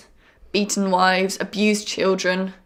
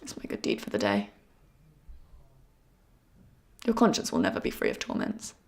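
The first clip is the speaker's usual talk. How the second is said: much lower and softer, a quiet threat; low menacing murmur; 11 semitones lower, 9 dB quieter than usual. Acted. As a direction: let it sink to a low, cold murmur. Final threat to the suspect.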